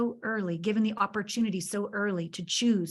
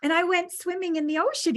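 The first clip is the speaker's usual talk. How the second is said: teasing tale; 9 semitones higher than usual. Pretend. Mom teasing son about toilet.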